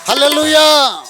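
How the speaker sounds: loud, high, triumphant cry; joyful hallelujah shout. Real, not acted. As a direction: shout it out in praise. Triumphant close of the song. (ఉత్సాహంగా బిగ్గరగా హల్లెలూయా అరుపు)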